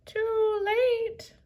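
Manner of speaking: high, shrill-free parrot croak; parrot squawk. Acted. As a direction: squawk it like a bird. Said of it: "the parrot squawked again".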